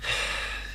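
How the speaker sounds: long, breath-only sigh; weary sigh. Acted. Nephew about to tell aunt of breakup, weary sigh. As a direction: let out a heavy sigh before confessing.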